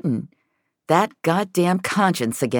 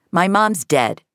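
deadpan; 6 semitones higher, 4 dB louder than usual. A narrator's reading. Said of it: "in that deadpan way kids".

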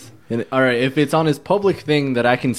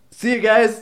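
mock cheerful exit; 9 semitones higher than usual. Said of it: "You're just like".

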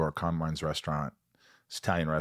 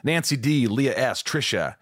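higher, lilting list of names; roll-call announcer; 5 semitones higher than usual. Acted. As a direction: call out each name like an announcer. Reads out patron roll call.

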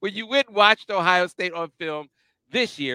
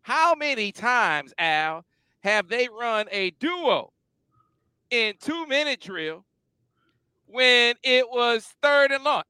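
higher, slow, spaced-out question; slow drawn-out question; 6 semitones higher, slower than usual. Retold or imitated. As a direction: stretch out each phrase as you pose the question. Retelling a detailed request to Al.

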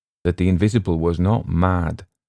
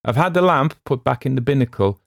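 higher, raised reassurance; steady raised hail; calling out; 8 semitones higher, 4 dB louder than usual. A narrator's reading. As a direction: call it over to the boat. Sailor calls to boat across water.

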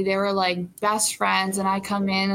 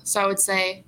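normal talking voice

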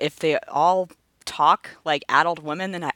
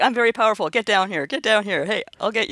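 higher, hammed-up villain taunt; mock boss taunt; 6 semitones higher than usual. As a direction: taunt like a cheesy boss calling up. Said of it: "they're like".

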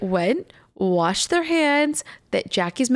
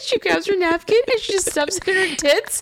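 usual speaking voice

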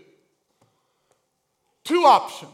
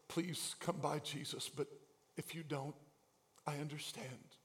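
very soft, low, held-back request; hushed, resigned asking; timid inward plea; 8 semitones lower, 19 dB quieter than usual. Acted. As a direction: say it small, as if afraid to be heard. Voicing those too proud to yell.

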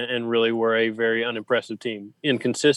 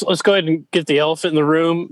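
ordinary talk

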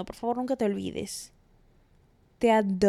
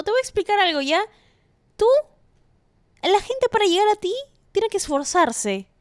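higher, louder pep-talk voice to a friend; 6 semitones higher, 4 dB louder than usual. Acted. Host lecturing her listener-friend higher and louder. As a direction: give advice like a fired-up best friend.

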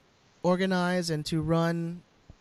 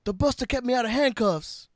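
higher put-on action-star voice; Vin Diesel; 5 semitones higher than usual. Host quoting a street-racing film, Vin Diesel impression. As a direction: play the movie tough guy, hammed up. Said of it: "that's my Vin Diesel".

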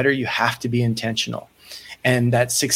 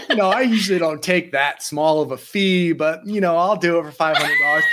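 joking, higher, as if doing him a favour; mock haughty; 5 semitones higher than usual. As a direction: pretend you usually charge more, tongue in cheek. Mock haughty reply about fee.

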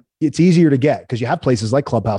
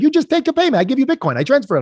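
high, sing-song Italian voice for the client; playful high Italian impression; imitating Italian accent; 12 semitones higher than usual. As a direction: mimic the Italian client's broken English, pitched up. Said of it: "he was Italian".

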